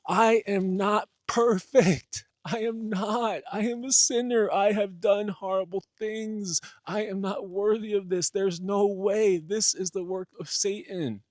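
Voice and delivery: high, loud desperate wailing; hammed-up anguished shouting. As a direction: plead and cry out, overdone despair. Jesus desperately refuses divinity.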